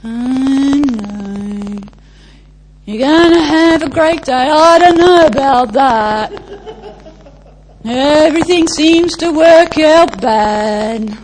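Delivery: Eeyore voice. Retold or imitated. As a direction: sigh it out like a gloomy Eeyore. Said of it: "Do you know the Eeyore spirit?".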